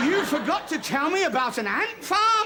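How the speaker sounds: high, slow incredulous protest; outraged disbelief. Acted. Husband learning of the ant farm, outraged disbelief. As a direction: build to an indignant high finish.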